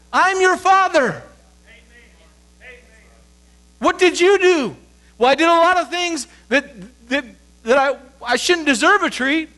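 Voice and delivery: high, loud, slow, driving sermon voice; full-force preaching. Acted. A pastor drives home grace to the congregation, loud and slow. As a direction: preach it hard, pressing each phrase.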